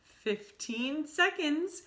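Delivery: slow, high, loud witch cry. Acted. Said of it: "cried the Grand High Witch".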